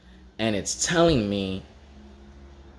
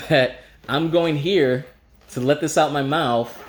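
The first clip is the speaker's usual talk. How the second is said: ordinary talk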